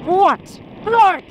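high, rising astonished what; amazed disbelief. Real, not acted. Rider seeing the scenery, amazed and delighted. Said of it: "I'm not an excited kid".

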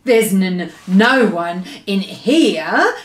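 nervously stammered denial, fairly loud; storybook giant stammering in fright. A narrator's reading. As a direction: stammer on the first sounds, then slow down. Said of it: "he stammered".

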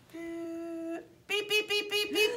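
high rising beep-beep like a security scanner; mock scanner beeps. Airport officer playing the scanner, high mock beeps. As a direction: play the X-ray machine beeping at the luggage.

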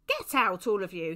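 loud, stern order; forceful stern priest. A narrator's reading. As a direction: order them out sternly, raising your voice. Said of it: "he said sternly".

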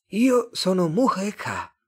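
high, slow alien voice; drawn-out, high-pitched Martian. A narrator's reading. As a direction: introduce yourself as an otherworldly being. Martian character introduces himself.

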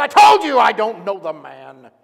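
loud furious denial; angry. Acted. Peter snapping at the man who accuses him, loud and angry. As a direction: yell it, fed up and cornered. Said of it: "very violent and very angry".

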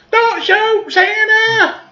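high falsetto cry for mercy; hammed-up high-pitched begging; mock plea. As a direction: squeal a comic warning. Voicing a victim on the roof.

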